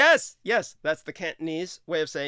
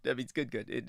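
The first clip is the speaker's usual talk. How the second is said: ordinary talk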